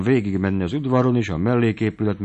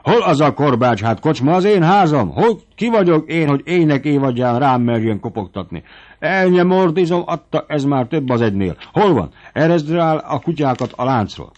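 higher and louder, roaring at the servant; an old squire flying into a rage; shouting indignantly for the whip; furious outburst; 7 semitones higher, 6 dB louder than usual. A narrator's reading. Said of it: "ugrott fel Palojtai dühösen".

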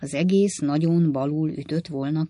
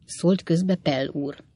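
plain reading voice

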